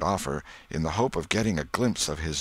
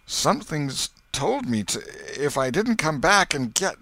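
higher, shaken voice; trembling Potter; 9 semitones higher than usual. A narrator's reading. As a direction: stammer it out, shattered.

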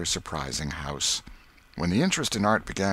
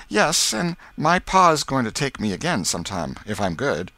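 put-on higher, louder little-girl voice; eager girl Becky; 7 semitones higher, 5 dB louder than usual. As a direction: play an excited schoolgirl. Becky's lines in the story.

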